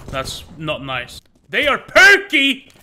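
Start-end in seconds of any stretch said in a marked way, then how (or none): 1.5-2.7 mock indignant defense.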